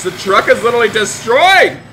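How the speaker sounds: high, loud cry of dismay; dismayed outburst. Pretend. Driver finds the truck destroyed, dismayed outburst. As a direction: exclaim in play outrage.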